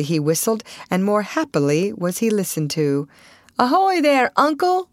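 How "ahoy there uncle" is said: high, carrying hail; calling out. A narrator's reading. Young man hailing an old boatman, calling out. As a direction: hail someone across the water. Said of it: "called Russ".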